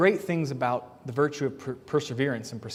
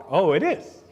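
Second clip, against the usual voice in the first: louder, lifted mock-interested reply; mock surprise; 3 semitones higher, 5 dB louder than usual. Parent answering a child's "too hard", mock surprise. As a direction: feign keen surprise at the complaint. Acted parent pouncing on complaint.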